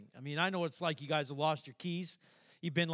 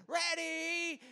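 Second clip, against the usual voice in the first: put-on child; 11 semitones higher than usual. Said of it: "And they go".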